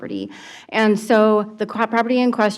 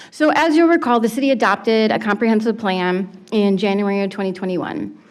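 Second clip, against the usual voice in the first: ordinary talk, nothing marked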